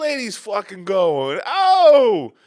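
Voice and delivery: high, drawn-out mock catcall; hammed-up slow street heckle, climbing to a shout; imitated catcall. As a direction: holler out the car window, stretching it into a loud whoop. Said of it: "he just leans over me and goes".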